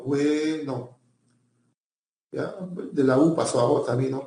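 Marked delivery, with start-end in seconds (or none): 0.0-1.0 "bueno" with a long, held UE; stressed diphthong. Shown as a demonstration. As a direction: draw out the UE in "bueno" to demonstrate it. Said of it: "pasó a diptongo".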